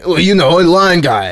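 tout's voice. Retold or imitated. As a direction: belt out Lion Guy like the pushy tout. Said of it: "Can't do the voice without coughing".

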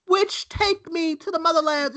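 high, hammed-up second-grade-play delivery; mocking child actor. Host mocking the boy's monologue in a high put-on voice. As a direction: mimic a kid overacting in a school play. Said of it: "as if I was listening to a fucking second grade play".

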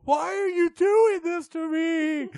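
very high, loud slow wail; Mister Spindle in distress. Retold or imitated. Mister Spindle pleading with the caller, high wailing shout. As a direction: shriek it helplessly down the line. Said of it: "He's like".

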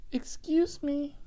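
high, slow, small-voiced "Excuse me"; little old lady. Comedian imitating the little lady in the back seat, high and slow. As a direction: play the tiny lady asking politely and slowly.

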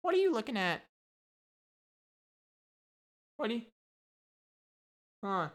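high squawky parrot sneer; mock rude parrot. Cursing parrot taunts the gawking visitors, high mocking squawk. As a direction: talk down to the zoo visitors like a mean parrot. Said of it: "just like".

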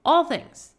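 "All things" punched high and loud; "All things" said high, loud and emphatic; stress on All things. Shown as a demonstration. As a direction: hit "All things" hard, with a falling tune. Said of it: "repeated emphasis".

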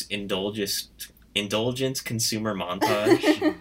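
slow, drawn-out, emphatic reading; slowly spelling out an all-caps stage direction; all-caps emphasis. Shown as a demonstration. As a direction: read each word like a headline, with a laugh. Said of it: "I just wrote in all caps".

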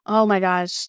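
usual speaking voice

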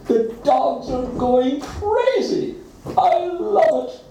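the cat gloats over the barking dogs; gloating. A narrator's reading. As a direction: savour the chaos.

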